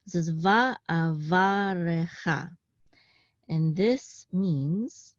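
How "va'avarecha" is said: "Va'avarecha" drawn out slowly, every vowel sounded; sheva pronounced. Shown as a demonstration. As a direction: say "Va'avarecha" slowly with the middle "re" fully voiced. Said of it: "you do pronounce it".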